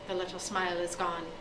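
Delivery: ordinary delivery, nothing marked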